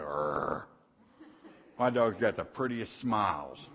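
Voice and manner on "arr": low mock dog growl. Preacher imitating a dog on a bone, low growl. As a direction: snarl like a dog guarding its bone. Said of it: "like that dog on the bone and go".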